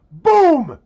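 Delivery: loud, high-pitched boom; sudden explosive exclamation; mock cannon blast. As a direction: burst out like a cannon firing. Imitates a cannon going off.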